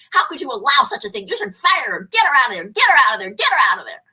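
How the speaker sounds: loud caller ranting in an ad voice; loud demanding radio caller; angry radio caller. Retold or imitated. Radio ad caller demanding the teacher be fired, loud. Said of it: "And you hear this voice".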